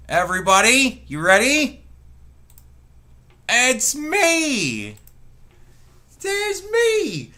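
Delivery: loud, high, thrilled announcement; excited reveal. Real, not acted. Streamer unveiling his webcam to viewers, loud excited. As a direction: show off the big surprise.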